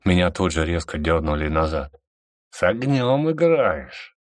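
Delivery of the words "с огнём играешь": slow, high mock hiss; lightly hissed threat. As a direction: menace it slowly. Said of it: "прошипел мне в лицо Рамир".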